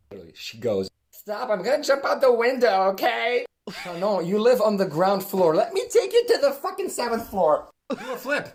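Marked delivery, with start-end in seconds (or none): 1.1-3.4 imitated high, very loud tantrum; mad outburst. Classmate threatening to jump after being corrected, imitated furious yell. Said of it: "She got so mad". 3.8-8.5 high, very loud exasperated retort; yelled comeback. Retold or imitated. Student answering classmate's window threat, shouting back.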